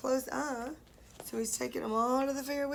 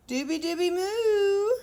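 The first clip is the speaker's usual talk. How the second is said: cow snore voice; 5 semitones higher, 4 dB louder than usual. Acted. Cows' snoring noise.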